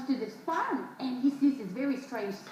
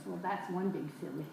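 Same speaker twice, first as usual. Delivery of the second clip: lower, softer wry remark; head-shaking verdict; 7 semitones lower, 6 dB quieter than usual. Acted. Man shakes his head at the farmer, low and wry. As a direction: say it low and dry.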